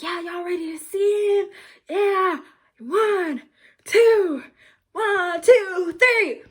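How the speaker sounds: high, loud hype countdown; hype man. Retold or imitated. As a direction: pump up the crowd. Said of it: "And he was like".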